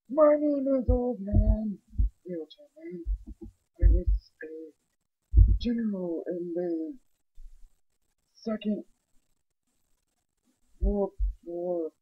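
put-on slow, high, strained old man. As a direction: croak it out like a war veteran. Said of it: "my old man voice is pretty bad".

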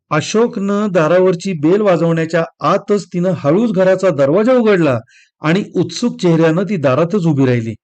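ordinary delivery, nothing marked

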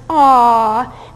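loud, fond aww; audience aww. Shown as a demonstration. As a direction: coo like a charmed audience. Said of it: "One is".

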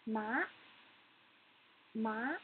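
"Ma" sliding upward; rising tone. Shown as a demonstration. Teacher demonstrates the second tone, "Ma" rising. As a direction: lift the pitch through "Ma" to the end. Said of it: "Let's do it again".

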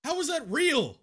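high, squeaky outrage; gamer rage. Real, not acted. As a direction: rage at the unfair parry. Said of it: "there's a little bit of gamer rage in this clip".

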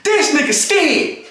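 high, drawn-out taunting movie quote. Retold or imitated. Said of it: "what [name] say in Juice".

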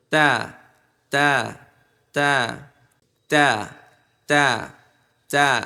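isolated, carefully placed "তা"; model "তা" pronunciation. Shown as a demonstration. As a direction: separate "তা", tongue at the root of the teeth. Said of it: "এটাকে এভাবে মশক করবেন".

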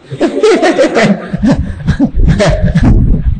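real, sustained chuckling; amused laughter. Real, not acted. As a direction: laugh at your own remark for a few seconds. Preacher laughs at own remark.

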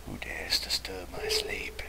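spooky whisper. Pretend. Voicing the prince.

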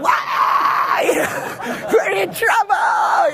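high, laughing mock wail; mock crying driver. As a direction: imitate the scared driver wailing. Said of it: "crying like a baby".

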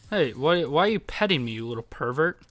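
normal talking voice

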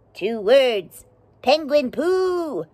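odd little bug voice, slow on penguin poo; a cheeky beetle announcing its reason; dung beetle voice. Acted. Dung beetle explaining the trip, cheeky and slow.

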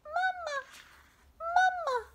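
put-on very high squeaky baby bird cries; tiny hatchling calling for mama; tiny gosling peeps. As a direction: peep it like a newborn chick.

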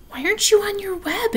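high-pitched, surprised question; puzzled young pig. A narrator's reading. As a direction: ask high, wanting to know why. Wilbur puzzled by her absence.

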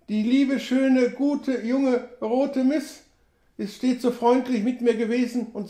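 put-on high, shaken old trapper in grief; Sam Hawkins voice. A narrator's reading. Sam mourns the young woman to the narrator, high and shaken.

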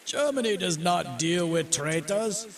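slow, high German officer voice; U-boat captain calling out. A narrator's reading. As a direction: declare it slowly, pitched high. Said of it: "he yelled".

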